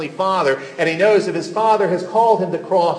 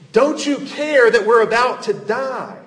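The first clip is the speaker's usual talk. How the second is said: raised, desperate cry of frightened disciples; panicked plea; 6 semitones higher, 4 dB louder than usual. Acted. Disciples crying out to sleeping Jesus, raised and desperate. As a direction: plead as if the boat is sinking.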